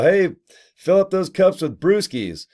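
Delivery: a light party-bro voice; mock party bro. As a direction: ham up a party bro urging drinks. Mock voice of game maker.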